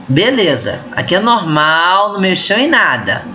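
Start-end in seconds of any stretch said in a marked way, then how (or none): none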